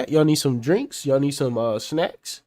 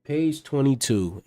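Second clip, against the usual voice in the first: slow deliberate reading; slower than usual. Real, not acted. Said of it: "reads the page number out".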